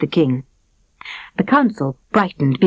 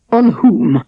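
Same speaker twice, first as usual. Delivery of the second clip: puzzled; 5 dB louder than usual. A narrator's reading. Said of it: "inquired the king, puzzled".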